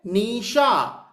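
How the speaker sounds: Nisha stretched very slowly to feel its vibration; teacher slows Nisha so students feel the sound; slow drawn-out name. Shown as a demonstration. Teacher drawing out Nisha very slowly for the class. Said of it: "vibration check करो क्या आ रही है?".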